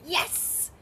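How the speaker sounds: high, sharp marker for the dog; high-pitched, hissy-ended cheer; yeehaw yes. Shown as a demonstration. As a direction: mark the moment with a celebratory yes. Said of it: "one of my yeehaw yeses".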